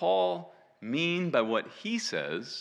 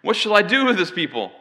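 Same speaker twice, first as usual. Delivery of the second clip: raised, pleading voice of an exasperated leader; crying out; 6 semitones higher, 6 dB louder than usual. Acted. Moses asks the Lord about the people, crying out. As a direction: cry it out, higher and louder on "do". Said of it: "Moses cried out to the Lord".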